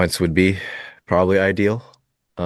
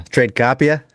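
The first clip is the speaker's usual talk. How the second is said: Tradecopia with a broad Boston accent; 7 semitones higher, 4 dB louder than usual. Shown as a demonstration. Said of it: "You have to say it in a Boston accent".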